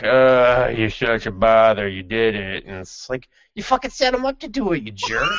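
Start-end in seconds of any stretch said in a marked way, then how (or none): 0.0-2.6 mock bored whine, a bit louder; hammed-up lazy complaint. Retold lazy vampire's excuse. 3.5-5.3 high, fast indignant squeak; hammed-up high-pitched accusation; mock outraged squeak. Speaker's own mock protest.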